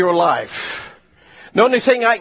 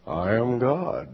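voice of God; 6 semitones lower, 6 dB quieter, slower than usual. Acted. God answers John.

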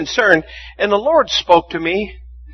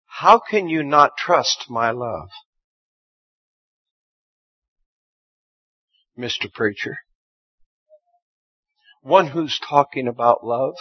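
gentle voice of God; 2 semitones lower, 3 dB quieter than usual. Retold or imitated. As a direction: lovingly chide the preacher. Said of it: "this voice said to me".

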